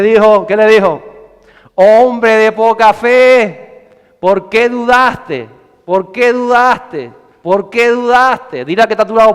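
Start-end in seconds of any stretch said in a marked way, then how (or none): 1.7-8.7 slow, raised-voice reproach; higher, deliberate preacherly challenge; drawn-out rebuke. Acted. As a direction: stretch every word, calling out the reproach. Jesus rebuking Peter, acted.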